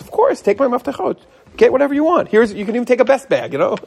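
high, bright friendly store owner; generous shop owner. Retold or imitated. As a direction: play the kind shop owner. Store owner voiced in story.

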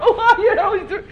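high, bright friendly phone greeting; sweet phone voice. Acted. Angry person suddenly answering the phone, sweet and high. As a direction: switch instantly to a cheery caller voice.

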